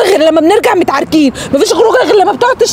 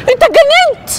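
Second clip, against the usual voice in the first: shocked outcry; 8 semitones higher than usual. Acted. Wife shocked after being slapped.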